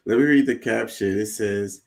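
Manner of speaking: normal talking voice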